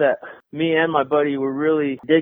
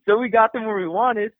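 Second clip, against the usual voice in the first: normal talking voice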